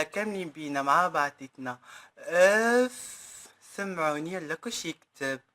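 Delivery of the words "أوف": long slow belted oof; slow ecstatic yell; loud drawn-out cry. Acted. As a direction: stretch the shout out to the crowd. Performer hypes crowd.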